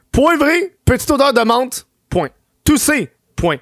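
high-pitched giddy note reading; stoned dictation. Real, not acted. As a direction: read your notes aloud, high and giddy. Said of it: "tu vois un peu quand je suis high, quand j'écris". (lecture défoncée, voix aiguë et excitée)

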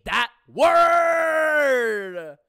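loud, high, stretched-out howl; appalled shout. Acted. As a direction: howl the last word in disgust. Appalled at his own vocabulary.

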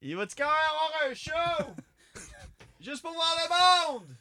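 squeaky high peasant voice, pleading; high villager voice. Pretend.

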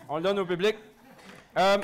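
talking with a mouth full of food; words muffled by a mouthful. Real, not acted. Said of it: "j'ai la bouche pleine".

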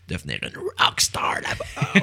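whispered through a laugh; breathy giggling whisper. Real, not acted. Joking aside about conquering France.

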